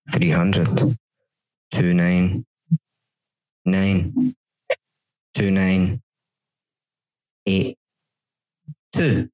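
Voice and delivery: low count with long pauses between numbers; imitated hypnotist, spacing out each number; hypnotist countdown. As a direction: count someone down into a trance. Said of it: "I'm gonna count back for three hundred".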